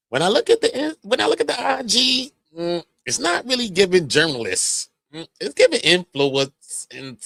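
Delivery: mocking dismissive tone. Quoting Tasha's dig.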